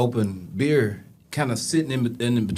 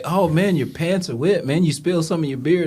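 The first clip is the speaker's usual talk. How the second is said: higher-pitched mock concern; retold surprise; 7 semitones higher than usual. Officer to a man with wet pants, mildly surprised. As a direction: act out the officer noticing a mess, pitched up.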